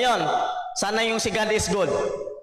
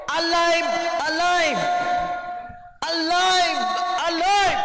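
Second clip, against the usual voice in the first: rising, louder cries of alive; 8 semitones higher, slower than usual. Shown as a demonstration. Preacher rousing the sleepy crowd, shouting alive. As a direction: pump up the crowd, calling out alive higher each time. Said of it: "Sa amin sa born again".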